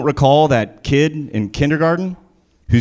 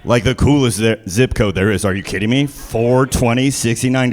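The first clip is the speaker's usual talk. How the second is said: normal talking voice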